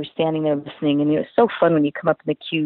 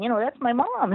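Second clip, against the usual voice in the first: put-on quieter, still high child's insistence; softer kid repeat; 6 semitones higher, 5 dB quieter than usual. Daughter repeating her claim to doubtful strangers, quieter.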